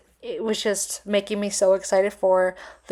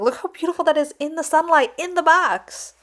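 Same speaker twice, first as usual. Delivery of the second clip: excited; 7 semitones higher, 4 dB louder than usual. Real, not acted. Admiring the ring in sunlight.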